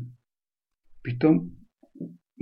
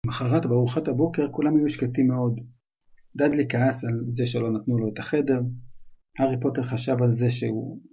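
ordinary delivery, nothing marked

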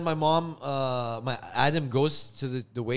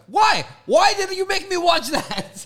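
much higher, louder wail; mock outraged whine; 8 dB louder than usual. Upset viewer reacting.

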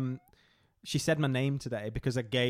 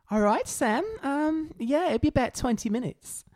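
waitress; 12 semitones higher than usual. Retold or imitated. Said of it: "she was like".

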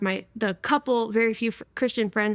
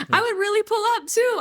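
laughing it out, pitched much higher; 10 semitones higher than usual. Real, not acted. Telling the host how eager she was, laughing high. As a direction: giggle through the words, amused at herself.

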